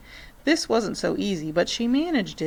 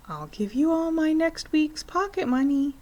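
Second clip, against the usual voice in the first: put-on higher child making an offer; bargaining girl; 9 semitones higher than usual. A narrator's reading. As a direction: tempt him with her pocket money. Matilda bargaining.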